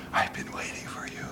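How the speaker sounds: an eerie, breathy whisper from the screen; creepy whisper. Retold or imitated. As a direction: whisper like a lurking machine. Computer voiced as tempter.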